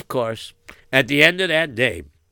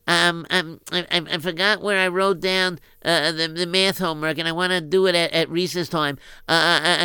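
put-on lightly raised flustered boy; a hint of a scatterbrained schoolboy; friend voice; 5 semitones higher than usual. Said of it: "he said".

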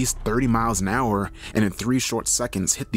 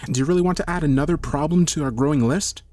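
urgent reasoning; 6 semitones higher, 4 dB louder than usual. Acted.

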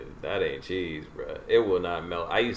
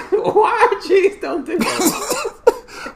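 high, slow, squealing through laughter; laughing echo; 12 dB louder, much slower than usual. Real, not acted. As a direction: stretch it out while cracking up.

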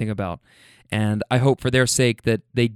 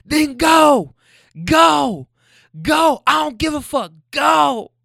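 high, loud mock shouts; retold screaming as the car pulls away; imitating her screaming; 13 semitones higher, 4 dB louder, slower than usual. Woman screaming at her boyfriend to leave, high shout. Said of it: "I can hear her screaming".

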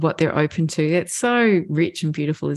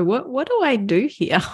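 lightly voiced puzzlement, pitched up; higher, mock-clueless questioning; puzzled young adult; 6 semitones higher than usual. Young adult leans on mom for guidance, lightly puzzled.

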